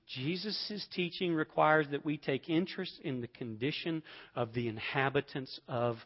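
ordinary delivery

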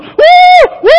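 high, loud joyful whoops; whooping shout. Acted. Boudreaux jumping in frozen hell, loud whooping shout. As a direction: whoop like a thrilled Saints fan. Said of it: "Boudreaux's jumping up and down going".

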